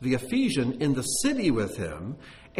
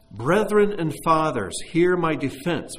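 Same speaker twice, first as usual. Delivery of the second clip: loud and slow orator's appeal; loud, slow opening of a defense speech; Paul addressing the crowd; 5 semitones higher, 6 dB louder, slower than usual. Acted. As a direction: plead to the crowd from the steps.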